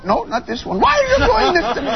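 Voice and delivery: high, laughing bad Irish leprechaun. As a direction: whine it like a hammy leprechaun. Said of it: "that was the worst leprechaun impression".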